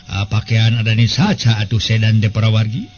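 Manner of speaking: ordinary delivery, nothing marked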